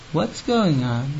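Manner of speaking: put-on slow, quiet half-asleep child asking; a drowsy child's bewildered question, slow and soft; sleepy kid. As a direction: mumble it like a kid just woken in pajamas. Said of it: "looks at me and says".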